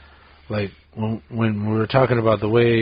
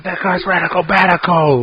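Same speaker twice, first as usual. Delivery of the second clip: higher-pitched mock excitement; excited kid; 6 semitones higher, 3 dB louder than usual. Kid spotting a Ferrari from the back seat, excited. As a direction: marvel like a kid at a cool car. Child admiring passing cars, retold.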